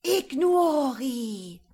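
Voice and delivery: slow, loud, high incantation cry; awed shout. A narrator's reading. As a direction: cry out the spell in awe. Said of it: "schrie voller Ehrfurcht".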